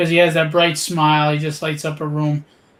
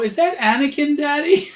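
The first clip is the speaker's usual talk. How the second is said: put-on little girl voice; 9 semitones higher than usual. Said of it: "They go".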